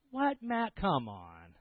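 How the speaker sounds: mock-incredulous, pitch leaping then falling away; disbelief. Pastor retelling his reply to Matt about the car, incredulous. Disbelief acted in retold phone call.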